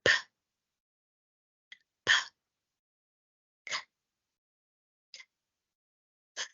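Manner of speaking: P and K as bare puffs of air, no voice; teacher modelling voiceless sounds; voiceless consonants. Shown as a demonstration. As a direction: push air out for P and K. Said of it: "Let's see".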